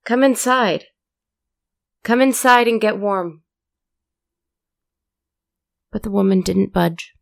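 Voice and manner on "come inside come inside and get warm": loud, high calls out into the rain; urgent outdoor shout, welcoming; shouting from the window. A narrator's reading. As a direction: call her in at full voice. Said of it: "opened the window and shouted".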